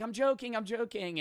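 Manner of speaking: high-pitched, sing-out mock reassurance; imitated joking backtrack. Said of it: "Okay, I'm not joking".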